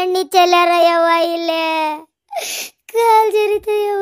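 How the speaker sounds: slow, tearful wail over a hurt leg; old woman crying after a fall; crying in pain. Acted. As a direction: sob it out, hurting and helpless. Old woman slipped on oil.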